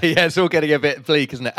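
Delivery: amused laughing. Real, not acted. Lightening a bleak talk for the host, amused laughing. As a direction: laugh through a wry remark. Joking about the bleak conversation.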